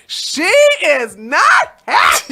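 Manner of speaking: high, loud, slow delighted wail; gleeful gossip. Real, not acted. Vlogger relishing the wife finding out, loud delighted wail. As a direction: savor the scandal, drawn out.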